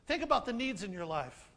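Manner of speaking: usual speaking voice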